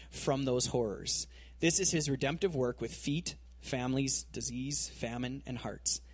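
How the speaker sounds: soft, reverent reading of a passage; preacher reading commentary to the congregation, softly reverent; reading aloud. Acted. Quoting a commentary to the church, soft and reverent.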